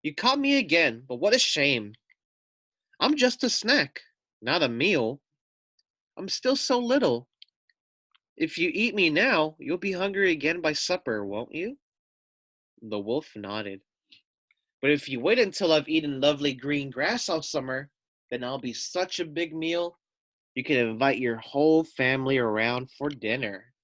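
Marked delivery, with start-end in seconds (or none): 0.0-11.8 high little lamb voice, coaxing. Acted. Lamb persuading the wolf not to eat her, high coaxing voice. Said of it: "she said". 14.8-23.7 coaxing lamb. Acted. Lamb's speech continues.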